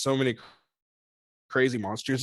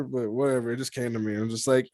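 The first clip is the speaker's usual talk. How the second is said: ordinary delivery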